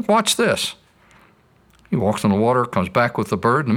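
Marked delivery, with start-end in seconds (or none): 0.0-0.8 playful God. Retold or imitated. God answers doubting man.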